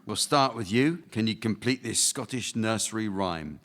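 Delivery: ordinary talk, nothing marked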